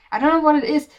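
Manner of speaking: loud, high burst of frustration; exasperated outburst. Real, not acted. Venting to viewers about entitled audiences, exasperated loud. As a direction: throw up your hands and let it out.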